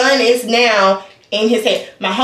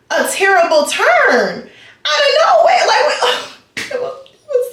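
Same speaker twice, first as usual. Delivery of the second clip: squealing laughter, much higher; laughing; 11 semitones higher than usual. Real, not acted. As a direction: crack up while telling it. Laughing at the story.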